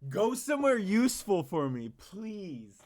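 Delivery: high, loud sneering echo; mocking repeat. Streamer parroting Seth's line in a high, loud, scornful echo.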